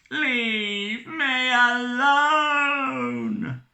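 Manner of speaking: slow, high sung-out wail; anguished wail. Acted. Haunted captain wailing at the ghosts.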